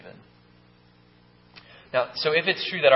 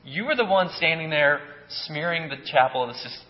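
accusing; 5 semitones higher, 5 dB louder than usual. Acted. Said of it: "and he says to us".

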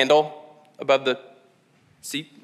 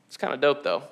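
grudging approval; 2 semitones lower, 6 dB quieter than usual. Acted. Acted fan grudgingly won over by fashion.

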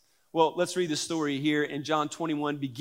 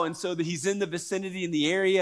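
ordinary talk, nothing marked

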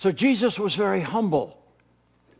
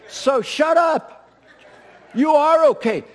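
playful scold; 10 semitones higher, 7 dB louder than usual. Pretend. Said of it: "preacher playfully scolding himself".

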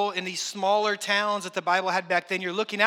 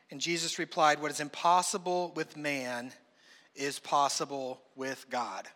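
measured, lower, softer Bible reading; scripture reading; 4 semitones lower, 4 dB quieter than usual. Acted. Pastor reading the Gospel to the congregation, quiet and measured. Reads the Gospel passage aloud.

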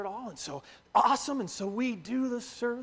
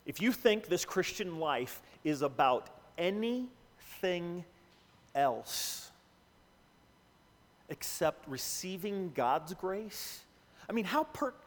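reflective preaching; 8 semitones lower, 4 dB quieter than usual. Acted. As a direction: bring it home quietly. Sermon point, soft preaching.

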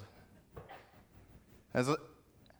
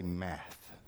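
dread-filled mumble; 8 semitones lower, 13 dB quieter than usual. Retold or imitated. Kid's answer in dead silence.

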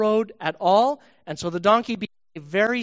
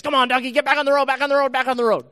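fast, higher, louder hurried scolding; impatient Balaam; 7 semitones higher, 7 dB louder, much faster than usual. Acted. Balaam scolding his donkey back onto the road, fast angry voice. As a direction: drive the donkey on, fast and cross. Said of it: "Balaam beats the donkey and says".

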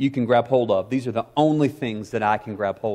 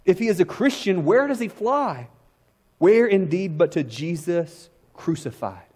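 quoting Spurgeon; 5 semitones higher than usual. A narrator's reading. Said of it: "And Spurgeon wrote".

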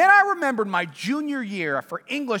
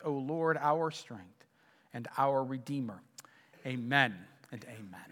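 prayer; 6 semitones lower, 4 dB quieter than usual. Acted. Pastor praying over the congregation, hushed and reverent.

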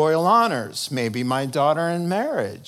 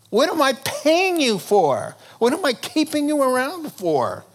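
indignant king; 10 semitones higher than usual. Acted. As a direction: scold them like a cheated boss. King scolding the psychics.

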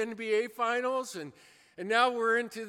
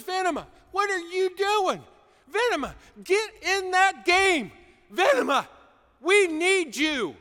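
loud, high coach yelling a player's name; coach shouting; 7 semitones higher, 9 dB louder than usual. Retold or imitated. Coach bending over the bench, shouting at his player. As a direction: bark at him to get in the game.

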